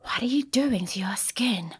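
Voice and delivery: conspiratorial half-whisper; hushed curiosity. Retold or imitated. Neighbour asks about client's skin, hushed whisper. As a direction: ask it under the breath, as if secret.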